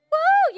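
high, loud preacher's whoop; joyful whoop. Real, not acted. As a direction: shout a happy woo. Preacher's whoop mid-sermon.

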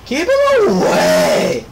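slow, high, loud frantic shout. Real, not acted. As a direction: yell it out in alarm.

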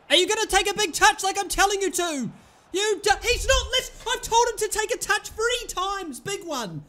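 high, loud, fed-up ranting; exasperated rant. Real, not acted. Gamer ranting at a striker who won't listen, exasperated. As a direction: scold the striker who ignores you.

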